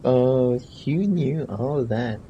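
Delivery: ordinary talk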